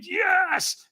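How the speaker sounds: very high-pitched retold scream; screamed yes. Ticked-off walker answers the trainer, screamed yes. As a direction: throw it out as a full-on scream. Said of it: "I screamed".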